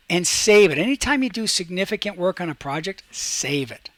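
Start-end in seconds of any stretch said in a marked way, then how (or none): none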